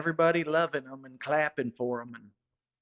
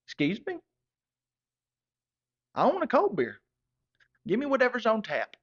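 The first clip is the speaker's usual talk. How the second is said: raised, higher indignant demand for a cold beer; 9 semitones higher, 4 dB louder than usual. Retold or imitated. To the bartender, an offended raised demand. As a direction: insist loudly on a real beer. Narrator's own indignant retold reply.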